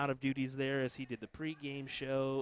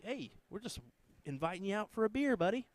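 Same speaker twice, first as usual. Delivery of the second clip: retold friendly invite, pitched up high; imitating a mate; 9 semitones higher than usual. Hedda calling on Sunday night, high mock matey voice. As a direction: play the cheerful pal calling you out for a beer.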